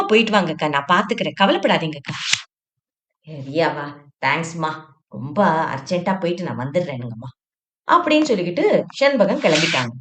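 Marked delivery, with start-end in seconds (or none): 3.3-7.3 a touch lower and softer, polite traveller; gently lowered voice asking to be seated; lightly voiced Shenbagam. Shenbagam asking the seated woman to move, polite and low.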